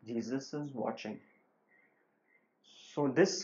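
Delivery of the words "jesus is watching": softer, hushed warning from nowhere; a disembodied voice, low in volume; hushed ghostly warning; quiet eerie voice. A narrator's reading. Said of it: "again he heard a voice saying".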